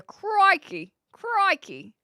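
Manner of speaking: high, loud Aussie exclamation; put-on Aussie accent, punched out. Streamer showing viewers a trained-ear test, hammed-up Aussie accent. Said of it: "It did sound pretty Aussie".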